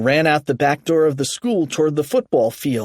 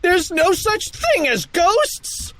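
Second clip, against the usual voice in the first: high, slow emphatic holler; yell; slower than usual. A narrator's reading. Kipper protesting to panicking buddies, high yell. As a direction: yell it insistently, drawing it out. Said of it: "Kipper yelled".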